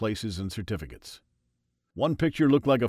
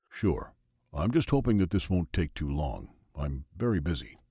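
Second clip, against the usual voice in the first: a lower, lightly voiced weary God; 6 semitones lower than usual. A narrator's reading. God explains the memo to Freud, lightly lower. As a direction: answer a little lower and a bit put out.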